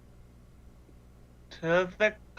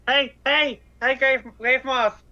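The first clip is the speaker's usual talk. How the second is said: higher-pitched call to get attention; 6 semitones higher, 4 dB louder than usual. Acted. Flyer hailing the Grave Moth as it passes, calling out. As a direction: call out to a creature in flight.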